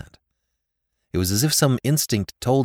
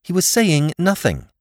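higher and louder, curt and defensive; a daughter answers her father curtly, higher and louder; rather shortly; 10 semitones higher, 5 dB louder than usual. A narrator's reading. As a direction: cut him off sharply. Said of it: "she said rather shortly".

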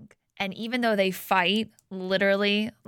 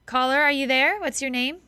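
calling out to a caller; 6 semitones higher than usual. Real, not acted. Host calling to phone caller.

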